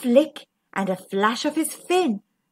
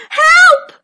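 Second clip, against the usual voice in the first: high, loud cry for help; flapping panic; 12 dB louder than usual. A narrator's reading. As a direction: shriek like a panicked fish. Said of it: "flapped the fish".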